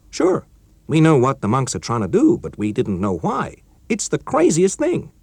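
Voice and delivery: put-on keen young colleague breaking news; character voice of Chuck, eager; Chuck, young American engineer. Chuck's voice in story.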